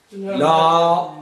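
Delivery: usual speaking voice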